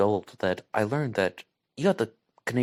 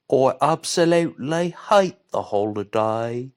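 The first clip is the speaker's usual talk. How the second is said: a loud, higher, slow stab at an English accent; attempted English accent; 5 semitones higher, 6 dB louder than usual. Shown as a demonstration. Vlogger attempts an English accent for viewers, loud and slow. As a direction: try on a posh English accent and overdo it. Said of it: "Can I do that in an English accent?".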